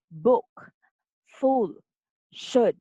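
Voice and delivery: BOOK, FULL, SHOULD with a short oo; short U examples. Shown as a demonstration. Teacher giving examples of short U, short vowels. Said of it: "we also have the short U".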